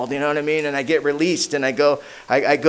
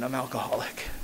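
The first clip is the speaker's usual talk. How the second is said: much softer, a hint of crying; tearful admission; 4 semitones lower, 13 dB quieter than usual. Newcomer finally admitting it to his sponsor, softly and tearfully. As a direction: let the words come out small. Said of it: "I started to cry".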